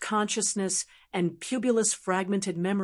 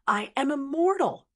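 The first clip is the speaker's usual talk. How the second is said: high-pitched, grand proclamation; declaring to the world; 6 semitones higher than usual. A narrator's reading. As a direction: announce your immortality to the world. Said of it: "declared to the world".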